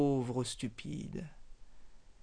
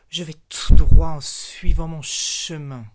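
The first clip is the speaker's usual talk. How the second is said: much louder, cold angry retort; angry snake; 16 dB louder than usual. Acted. Snake snaps back angrily at the frog, much louder. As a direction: bite the words off, fed up with the frog. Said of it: "répondit avec colère".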